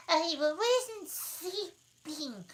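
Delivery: high, slow, hoarse sleepy dormouse; hoarse feeble voice. A narrator's reading. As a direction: croak it out weakly. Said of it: "in a hoarse, feeble sound".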